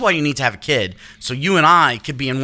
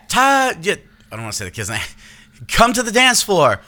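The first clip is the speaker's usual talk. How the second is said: put-on high sing-song announcement to the kids; mock party host call; 8 semitones higher than usual. Party host calling the kids to the dance floor, high and cheery.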